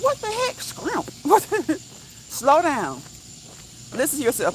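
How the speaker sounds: high-pitched incredulous question; mock bafflement. Reacting to someone saying scrimp, high mock bafflement.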